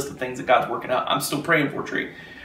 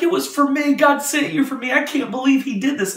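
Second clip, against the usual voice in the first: very high, excited voice of the grateful guard; retold amazement; 10 semitones higher than usual. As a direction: imitate her awestruck exclaiming, high up. Guard overwhelmed after being prayed for.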